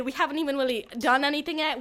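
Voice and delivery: ordinary delivery, nothing marked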